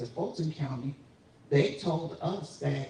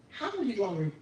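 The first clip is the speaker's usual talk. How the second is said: ordinary delivery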